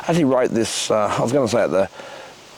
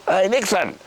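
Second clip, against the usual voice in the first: calling out; 8 semitones higher than usual. Acted. Mate calling out across the room.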